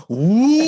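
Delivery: loud, high, drawn-out "woo"; soaring mock cheer; crowd's whoop. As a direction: imitate a whole crowd whooping in amazement. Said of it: "everyone was like".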